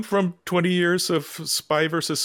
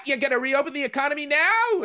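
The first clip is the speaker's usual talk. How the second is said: high-pitched incredulous bird voice; cartoon blue bird; 11 semitones higher, 4 dB louder than usual. Host reading a bird cartoon aloud to listeners, high cartoon bird voice. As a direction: squawk the question as a cartoon bird. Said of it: "the blue bird says".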